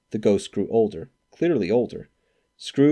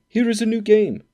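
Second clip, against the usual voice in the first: put-on high, boyish plea from old Scrooge; pleading like a boy; 12 semitones higher, 5 dB louder than usual. A narrator's reading. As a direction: beg the spirit like an eager child. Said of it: "he begged like a boy".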